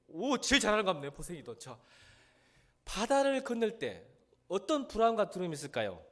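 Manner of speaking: high, loud fervent sermon voice; fervent preaching. Acted. Preacher rousing the congregation about gods, fervent. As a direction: preach it out with rising force.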